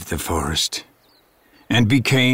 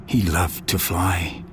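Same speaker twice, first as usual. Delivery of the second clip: ordinary delivery, nothing marked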